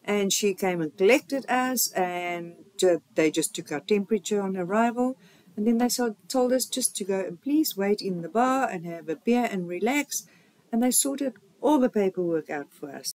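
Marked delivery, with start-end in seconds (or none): none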